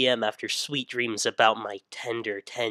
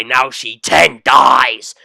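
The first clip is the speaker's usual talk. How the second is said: slow gloat; 11 dB louder, much slower than usual. Acted. Gloating pun.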